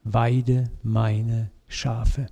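solemn commission. Retold or imitated. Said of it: "Und jetzt kommt es".

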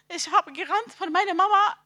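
normal talking voice

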